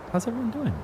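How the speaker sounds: squeaky high greeting. Pretend. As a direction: greet chat in a silly high voice. Streamer greeting chat playfully.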